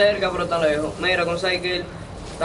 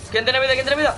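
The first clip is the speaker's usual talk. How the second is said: pleading, pitched up high; desperate call for a heal; urgent; 7 semitones higher than usual. Real, not acted. Begging teammates for health. (grito urgente pidiendo vida)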